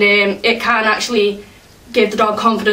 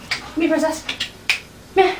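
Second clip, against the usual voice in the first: coaxing whisper; 5 semitones higher, 6 dB quieter than usual. Real, not acted. Coaxing dog to come over.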